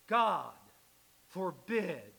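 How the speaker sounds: GOD and FORBID each loud, with a pause between; emphatic repudiation. Retold or imitated. Preacher voicing Paul's response to the congregation, emphatic repudiation. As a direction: thunder out GOD, break, then FORBID. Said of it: "Paul's most emphatic repudiation".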